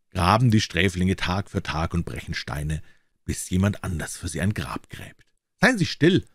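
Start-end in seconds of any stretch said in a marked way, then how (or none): 5.6-6.2 high, loud shrill outburst; shrill cry. A narrator's reading. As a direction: cut him off in panic. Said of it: "Templers Stimme klang schrill".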